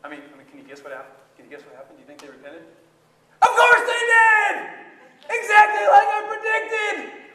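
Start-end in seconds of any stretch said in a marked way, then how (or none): 3.4-7.3 high, loud exasperated yelling; furious shout. Acted. Jonah fuming that Nineveh repented, angry shouting. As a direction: rage that they actually repented. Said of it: "and I was so mad!".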